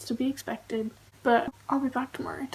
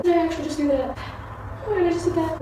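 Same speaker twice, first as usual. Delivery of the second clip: high, loud, appalled outburst; real panic, voice raised and pitched up; shocked disbelief; 5 semitones higher, 6 dB louder, much faster than usual. Real, not acted. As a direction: cry out as the mistake sinks in. Disbelief at own haircut.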